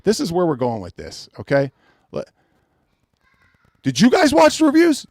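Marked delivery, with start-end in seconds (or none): 3.8-5.0 much higher, incredulous; high indignant disbelief; exasperated. Real, not acted. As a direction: demand answers from the chat. Angry rant at reviewers.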